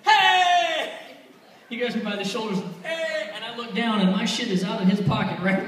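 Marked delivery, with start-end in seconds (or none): 0.0-0.9 high, loud, held-out "Hey"; shouting. Retold or imitated. Pickpocket grabbing the tourist by the shoulders, shouting. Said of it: "yeah, shouting and all that shit". 2.8-3.3 high-pitched mock shout. Stranger grabbing him by the shoulders, mock shouting. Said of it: "yeah, shouting and all that shit".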